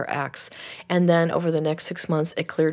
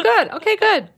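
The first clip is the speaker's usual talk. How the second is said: high, loud retold approval; amused approval; 5 dB louder than usual. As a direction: cheer her on, amused. Amused reply inside retold story.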